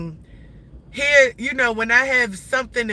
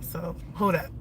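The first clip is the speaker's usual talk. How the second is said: tearful sigh; 4 semitones lower, 9 dB quieter than usual. Real, not acted. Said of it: "this is making me emotional".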